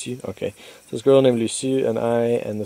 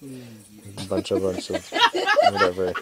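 laughing. Real, not acted. Laughing while retelling the mess.

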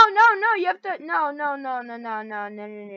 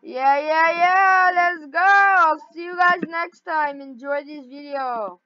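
hyped sign-off; 2 semitones higher, 7 dB louder than usual. Real, not acted. Player telling viewers about Among Us next, hyped up. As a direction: hype up the viewers as you sign off.

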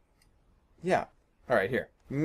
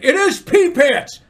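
much higher, louder, slow insistence; insisting; 12 semitones higher, 12 dB louder, slower than usual. Retold or imitated. High friend insisting on the password, loud and slow. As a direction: dig in and stress every word. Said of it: "And he was like".

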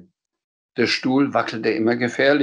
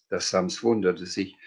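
plain reading voice

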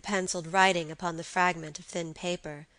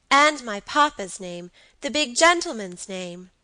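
put-on louder, slightly raised child's voice; little boy; 4 semitones higher, 6 dB louder than usual. A narrator's reading. Boy claims papa's name, childish and eager. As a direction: chime in like a small boy.

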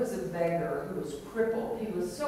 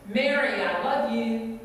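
loud, raised call across a distance; clear loud yell; 3 semitones higher, 6 dB louder than usual. Retold or imitated. Beggar calls after the woman walking away, clear loud yell. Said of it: "he yelled out in a very clear, loud voice".

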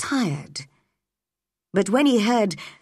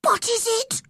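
put-on very high, breathless-eager boy; excited whisper. A narrator's reading. Boy promises to keep a secret, excited whisper. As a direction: squeal it under your breath. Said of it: "he whispered excitedly".

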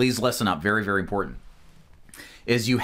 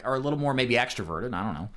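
ordinary talk

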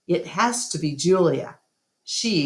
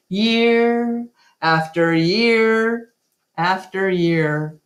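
slow, weary, drawn-out repetition; 3 semitones higher, 4 dB louder, slower than usual. A narrator's reading. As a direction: let each year drag on, slowly. Dragging repetition, slowed.

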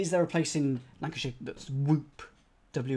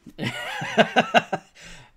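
loud squealing laugh; high burst of laughter; 9 dB louder than usual. Real, not acted. Host laughing at a chat comment, high and loud.

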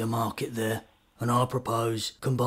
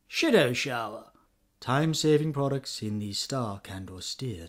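advert voiceover; 3 semitones higher than usual. Acted. Mock advert voiceover pitch.

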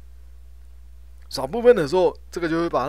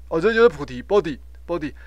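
ordinary talk, nothing marked

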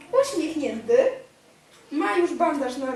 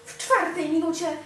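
usual speaking voice

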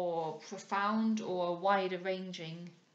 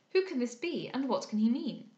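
raised, puzzled exclamation; mild consternation; 5 semitones higher, 6 dB louder than usual. A narrator's reading. Cecilia asking the company about the strange man, mild consternation. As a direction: ask it as a baffled, higher-pitched demand. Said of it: "somewhat recovered from her consternation".

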